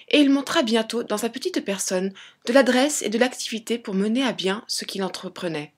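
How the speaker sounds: ordinary delivery, nothing marked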